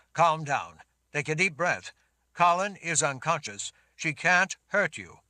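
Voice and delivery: loud, steady man's voice; firm reassuring Richard. Acted. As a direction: firmly settle a panicked girl.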